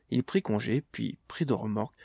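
ordinary delivery

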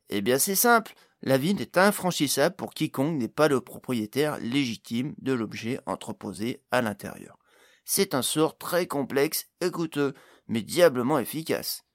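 animated gnome character voice; explaining gnome. Acted. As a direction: lay out the spell like a keen gnome specialist.

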